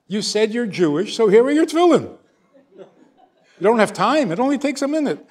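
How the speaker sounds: high, loud and rushed, laughing at the end; pushing past his excuses; excited boy barrelling on with a laugh; impatient insistence. Retold or imitated. Boy's impatient thoughts, acted out.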